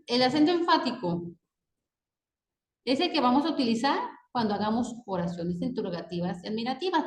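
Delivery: ordinary delivery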